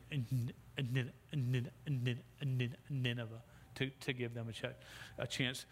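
normal talking voice

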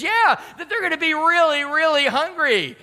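high, loud, stretched-out insistence; hammed-up high-pitched urgency; mock emphatic pleading. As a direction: imitate a wife fervently making her case. Wife pleads for hungry runners, retold.

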